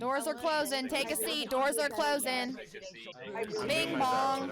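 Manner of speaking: high, announcing train doors closing; train conductor calling. Retold or imitated. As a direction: call it like a subway conductor. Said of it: "Sounds like a train conductor".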